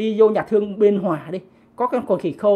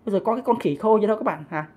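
ordinary delivery

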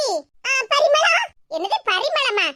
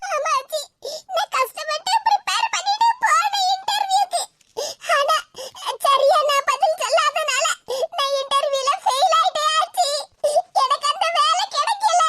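halting, tearful wail; crying; 4 semitones higher than usual. Acted. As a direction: sob out the bad news with pauses. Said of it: "இப்படி கிடந்து கண்ணீரை வடிச்சிட்டு கிடக்கா". (அழுகையோடு திக்கித் திக்கி)

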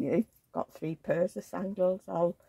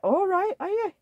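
mock chirpy greeting; 12 semitones higher, 5 dB louder than usual. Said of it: "I went".

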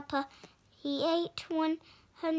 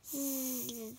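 soft snore noise; snoring; 2 semitones lower, 8 dB quieter than usual. Acted. The giant snoozes, soft snore sound. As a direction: buzz a sleepy snore. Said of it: "he was snoozing".

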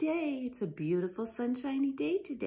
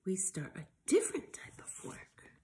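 hushed farmer; 4 semitones lower, 4 dB quieter than usual. Acted. Farmer Bill's line trails to whisper.

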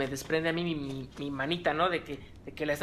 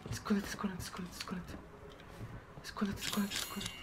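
softer whispered urging; urgent whisper; 3 semitones higher, 10 dB quieter, faster than usual. Real, not acted. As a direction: hiss at yourself to hide. Se urge a esconderse. (susurro urgente)

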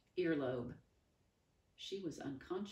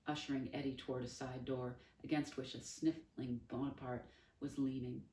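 ordinary delivery

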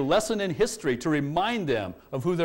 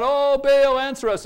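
calling out; 10 semitones higher, 6 dB louder than usual. Retold or imitated. Prophets calling on Baal again.

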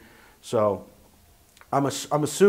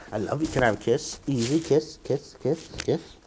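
cooing. Real, not acted. Asking pet for kisses.

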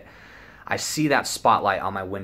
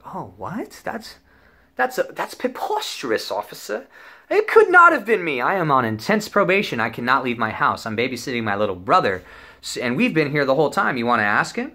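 mock outrage; 4 semitones higher than usual. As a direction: play the wrongly accused, voice shooting up. Mock outrage denying to the cop.

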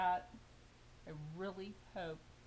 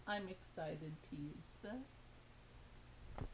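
ordinary delivery

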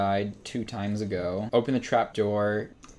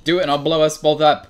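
loud, fast mock threat at the monster; 1 shouted threat; 6 semitones higher, 11 dB louder, faster than usual. Real, not acted. Gamer yelling a threat at the creature, loud and fast. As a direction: yell it like a cornered hero.

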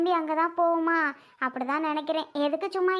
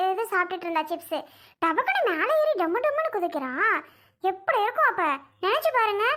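higher voice, indignant scolding; a young mother telling off her child and defending herself; raised, agitated mother scolding her daughter; 4 semitones higher than usual. Acted.

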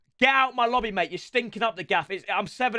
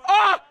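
high, loud cry; sudden yell; 9 semitones higher, 6 dB louder than usual. Real, not acted. Gamer reacting to a miss, sudden yell. Cries out at game.

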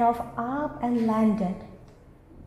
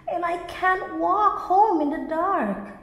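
high, louder whining wail; 7 semitones higher, 4 dB louder than usual. A narrator's reading. Greedy bee to the fireflies, high wail. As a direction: whine about the dark. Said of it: "wailed the greedy bee".

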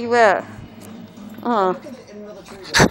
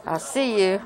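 usual speaking voice

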